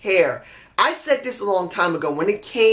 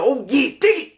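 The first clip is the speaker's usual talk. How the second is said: a quick hint of the Macho Man, pitched up; 5 semitones higher than usual. Fan briefly imitating Macho Randy Lethal, lightly. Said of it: "switched from Jay Lethal to Macho Randy Lethal".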